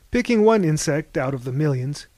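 usual speaking voice

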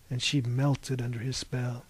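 ordinary delivery

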